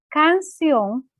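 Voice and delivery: canción drawn out slowly, weight on the final -ción; stress on final syllable. Shown as a demonstration. Teacher showing students where the accent falls, canción stretched with stress on ción. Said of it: "¿dónde tiene el acento?".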